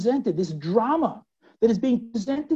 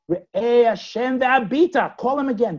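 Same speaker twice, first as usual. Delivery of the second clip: calling again; 3 semitones higher, 5 dB louder than usual. Acted.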